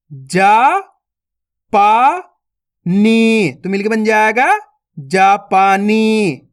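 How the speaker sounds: each syllable spaced out, then the word blended slowly; syllable-by-syllable reading. Shown as a demonstration. Teacher to young students: slow sounding-out of each syllable.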